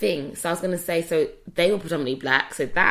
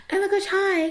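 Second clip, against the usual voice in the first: high, louder squeal of hello; gushing greeting; 5 dB louder than usual. Retold or imitated. Greeting closed-off people at an event, high and gushing. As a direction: act out an over-friendly hello, pitched way up.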